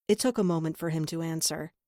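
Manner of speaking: ordinary delivery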